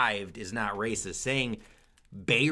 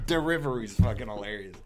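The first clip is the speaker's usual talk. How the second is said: ordinary talk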